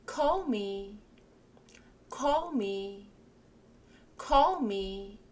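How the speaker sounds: "Call me" said clearly and evenly; repeat drill. Shown as a demonstration. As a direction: say it for learners to copy. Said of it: "같이 한번 읽어보실게요". (또박또박 따라 읽기)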